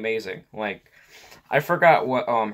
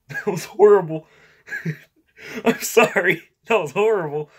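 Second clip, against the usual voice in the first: real laughter, high and loud; helpless giggle through the words; laughing; 8 semitones higher, 5 dB louder than usual. Real, not acted. Vlogger apologising for a horrible attempt, laughing. Cracking up at own failed voice.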